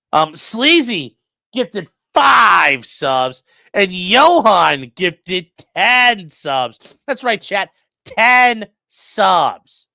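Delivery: loud, slow, drawn-out announcer hype; mock announcer. Streamer hailing chat's gifted subs, mock announcer. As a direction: proclaim it like a big public announcement.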